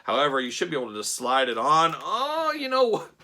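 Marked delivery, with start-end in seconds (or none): 2.0-3.1 sudden high exclamation, pitch jumping up; surprised. Real, not acted. Reviewer realising the head slides on, surprised. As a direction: caught off guard by the head fitting.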